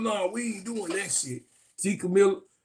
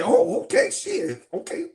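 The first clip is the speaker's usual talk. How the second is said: mock surprise; 10 semitones higher than usual. Voicing Sanaa surprised.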